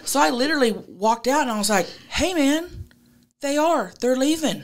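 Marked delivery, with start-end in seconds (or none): 2.1-4.6 high-pitched retold appeal to an angry neighbor; calling out placatingly.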